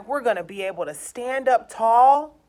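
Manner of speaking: usual speaking voice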